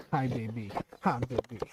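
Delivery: low, gentle puppy greeting. Real, not acted. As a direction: murmur it to the puppy.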